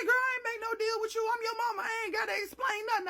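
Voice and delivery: high, loud, fast snapping mama; mama scolding. Retold or imitated. Mama refusing to explain to daughter, loud fast scolding. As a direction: brush her off as the mom. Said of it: "She said".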